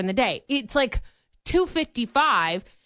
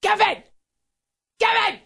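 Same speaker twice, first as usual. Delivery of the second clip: yelling a name across the room, louder each time; calling out; 12 semitones higher, 3 dB louder than usual. Retold or imitated. Trying to catch Kevin's attention, shouting his name. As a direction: shout for a coworker's attention, rising. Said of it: "So I'm like".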